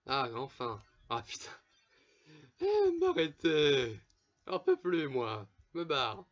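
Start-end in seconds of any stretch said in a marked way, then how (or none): 2.6-5.1 slow, loud, whining complaint; whiny plea. Real, not acted. Player begging the monsters to stop attacking him, loud whine. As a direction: moan it out like you're fed up. Pleading as enemies keep attacking.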